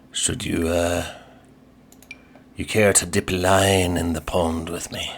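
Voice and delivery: lightly voiced pirate; a hint of a pirate sailor. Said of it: "'cause it's Talk Like a Pirate Day".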